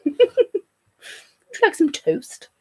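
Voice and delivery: slow, drawn-out posh British offer through a laugh; mock posh British. Offering toast to the stream in mock posh British. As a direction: ham up a plummy English host, laughing. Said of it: "I'm very British, don't you know?".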